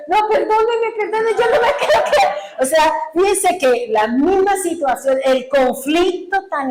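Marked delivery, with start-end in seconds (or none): 0.1-2.4 high, fast mock-desperate begging; panicked pleading. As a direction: re-enact a frantic plea for forgiveness, pitched way up. Acts panicked plea to the Lord. (súplica desesperada, aguda y atropellada)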